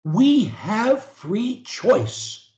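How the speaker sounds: very slow, each word spaced for repeating; slow dictation. Shown as a demonstration. Coach leading a client in repetition, very slow. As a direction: say it slowly for them to copy. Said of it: "say after me".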